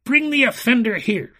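high, loud tyrant's order; commanding shout. A narrator's reading. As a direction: bellow the order to your guards. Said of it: "commanded the enchanter".

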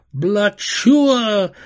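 loud, high imitated burst; exploding fireworks. A narrator's reading. Fireworks breaking into the girl's thoughts, loud high burst. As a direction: burst it out high and let it fall. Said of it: "Carnival fireworks exploded".

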